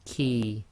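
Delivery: "ki" light and forward in the mouth; crisp light k; light kaf. Shown as a demonstration. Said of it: "a very light version of the qaf".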